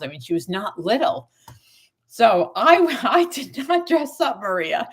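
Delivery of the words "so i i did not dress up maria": breaking into a high laugh mid-line. Real, not acted. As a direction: laugh through the words, amused at herself. Laughing at own story.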